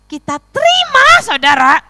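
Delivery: loud, high proclamation; full-force preaching. Real, not acted. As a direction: thunder it out to the congregation.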